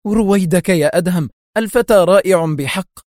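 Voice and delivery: high, animated protest; lively, enthusiastic defence of someone. A narrator's reading. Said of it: "هتف أحمد في حماس".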